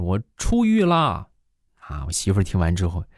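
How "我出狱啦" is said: high, slow, drawn-out cry of freedom; joyful yell. Retold or imitated. Husband after a bath yells to the house, high and slow. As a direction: shout it out like a man just set free. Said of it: "禁不住我就大喊一声".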